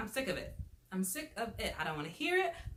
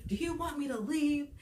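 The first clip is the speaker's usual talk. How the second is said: raised, higher pleading question; exasperated offer; 7 semitones higher, 5 dB louder than usual. Retold or imitated. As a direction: boyfriend offers to leave the fighting girlfriend, pleading loudly. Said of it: "he's like".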